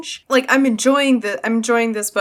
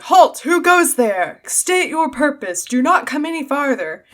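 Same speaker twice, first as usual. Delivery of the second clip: Mag challenging strangers; 6 semitones higher than usual. Retold or imitated. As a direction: bark it like a sentry halting riders in the dark. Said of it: "Mag is like".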